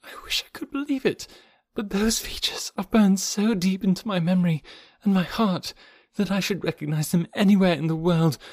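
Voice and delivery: high-pitched, anguished pleading; moaning. A narrator's reading. Jane insisting she knows those features, moaning with anguish. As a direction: start on a breathy whisper, then build to fervent grief.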